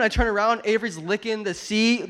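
usual speaking voice

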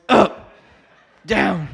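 a hint of Miyagi calling up, down; Pat Morita voice. Preacher imitating Mister Miyagi, up and down. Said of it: "Like my Pat Morita voice".

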